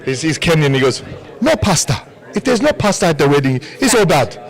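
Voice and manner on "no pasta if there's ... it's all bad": put-on African accent. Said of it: "my really stereotypical African accent".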